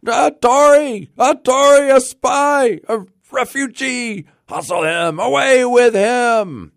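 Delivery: very high, loud mob outcry; crowd's shout. A narrator's reading. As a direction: shout accusations like an angry crowd. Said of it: "a general shout burst from the bystanders".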